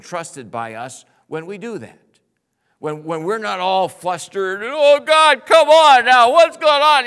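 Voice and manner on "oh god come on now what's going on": put-on whiny child voice, much higher and louder; impatient bratty child griping at God. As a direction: whine it like a spoiled kid. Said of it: "that's the bratty child".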